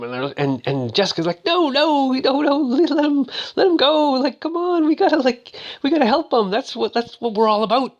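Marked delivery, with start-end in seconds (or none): 1.4-8.0 very high imitated woman's plea; pleading. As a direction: plead urgently in falsetto. Voicing Jessica pleading for a life.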